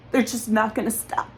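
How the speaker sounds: usual speaking voice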